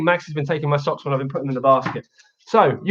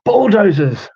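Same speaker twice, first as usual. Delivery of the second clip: put-on reading to children; 5 dB louder than usual. Reads picture book to kids.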